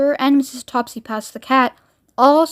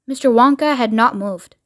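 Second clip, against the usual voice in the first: ordinary delivery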